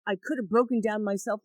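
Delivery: normal talking voice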